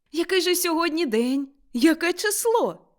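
high, excited questions; eager questioning. A narrator's reading. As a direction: ask in wondering excitement. Scrooge eagerly asks the date.

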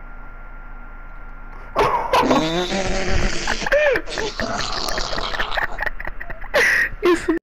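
long high wheezing laugh; high breathy cackling fit; laughter. Real, not acted. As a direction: crack up helplessly, mostly breath. Gamers cracking up mid-game.

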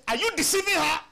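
high, loud accusation; retold loud accusation; accusing shout. Pastor confronts young man.

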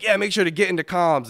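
mock intense teammate; tryhard teammate. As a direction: mimic a tryhard giving orders.

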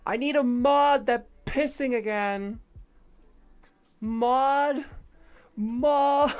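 high, loud mock panic call; shouting for help. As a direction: yell for a mod like it's an emergency. Calling for moderator.

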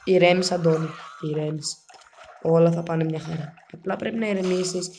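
low, soft muttering to himself; calming self-talk. Real, not acted. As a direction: talk yourself down quietly. Calming himself down mid-game. (χαμηλόφωνα, καθησυχαστικά)

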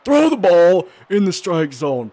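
loud, high-pitched command; frustrated yell. Real, not acted. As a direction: yell at your pitcher in exasperation. Frustrated at own pitcher in game.

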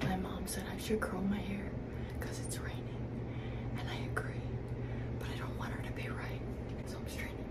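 hushed secret whisper. Real, not acted. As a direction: whisper it so mom can't hear. Whispering so mom won't hear.

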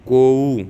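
ਕੋਊ said "ko-oo", o then long u; ਕੋਊ as a clear glide from "o" to "oo"; demonstrated pronunciation. Shown as a demonstration. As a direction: sound both vowels in ਕੋਊ. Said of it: "ਇਹਨੂੰ ਕੋਊ ਬੋਲਣਾ".

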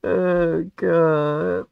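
high-pitched laughter; laughing. Real, not acted. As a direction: laugh it out in disbelief. Laughing at game moment.

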